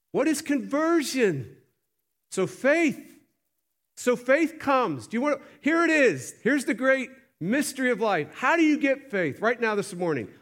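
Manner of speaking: high, driving preacher's cry; preaching at full force. Acted. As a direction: press the congregation hard with each question.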